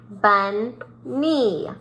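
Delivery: BUNNY broken in two beats, BUN, then NEE; split syllables. Shown as a demonstration. Teacher showing pupils two syllables, BUNNY split in two. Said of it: "You would hit your chin twice".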